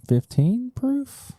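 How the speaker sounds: high, drawn-out surprise; stunned by how strong it is; surprised question. Real, not acted. Surprised at proof, high slow.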